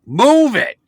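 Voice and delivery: furious high shout out a car window; angry yelling. Retold or imitated. Grandpa yelling at hacky sack kids blocking the parking lot, furious shout. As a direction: yell at the kids to get out of the way. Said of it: "He's yelling out the window".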